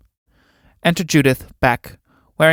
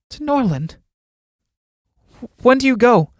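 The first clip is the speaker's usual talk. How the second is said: high-pitched, dismayed questions; startled questioning; 10 semitones higher than usual. Acted. Judith hearing of Alan's transfer, startled and pressing him. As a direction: pitch leaps up as the news sinks in. Judith learns where Alan is going.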